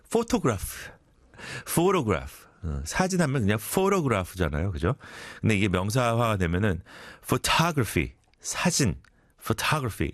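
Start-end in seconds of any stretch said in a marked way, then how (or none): 0.1-0.9 photograph with the weight on the first syllable, PHO-to-graph, up high; stress on PHO. Shown as a demonstration. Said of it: "힘든 것". 7.2-8.1 photography with the stress on the second syllable, pho-TOG-ra-phy. Shown as a demonstration. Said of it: "the accent is on the, 어, O".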